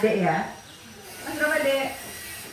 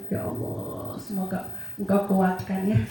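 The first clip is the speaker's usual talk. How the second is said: tearful prayer; 4 dB quieter than usual. Retold or imitated. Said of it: "Saya itu nangis begitu".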